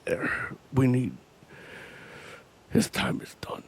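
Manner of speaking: gravelly, near-voiceless whisper; halting grunt sinking into a whisper; whispering Kingpin. Retold or imitated. Imitating Kingpin among people, whispered growl. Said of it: "what the fuck you fucking whispering for".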